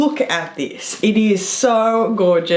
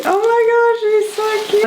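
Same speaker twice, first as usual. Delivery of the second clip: delighted squeal; 13 semitones higher, faster than usual. Real, not acted. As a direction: gush with delight. Reading Sandy's note.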